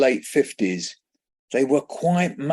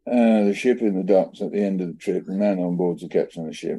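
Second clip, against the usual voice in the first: slurred mumble; 6 semitones lower than usual. Retold or imitated. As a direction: mumble the poem half-asleep. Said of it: "my speech was so slurred".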